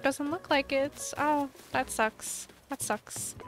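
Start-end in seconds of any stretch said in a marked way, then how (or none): 2.7-3.4 whispered letdown. Real, not acted. Disappointed aside.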